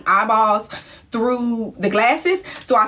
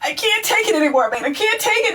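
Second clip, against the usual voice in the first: mock crying friend; 8 semitones higher than usual. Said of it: "so she's like".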